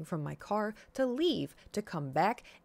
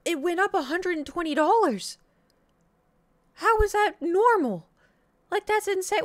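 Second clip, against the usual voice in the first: high, loud indignant protest; outraged; 9 semitones higher, 6 dB louder than usual. Retold or imitated. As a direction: push back in disbelief. Arguing with mom about insurance.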